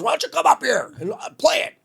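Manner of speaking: loud, high-pitched mock dare; retold challenge. DJ daring a pestering young caller to come up, loud mock challenge.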